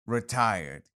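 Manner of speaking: lightly voiced retired soldier; a hint of a retired grenadier; character voice. Outro in Widget character.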